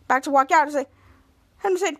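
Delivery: ordinary delivery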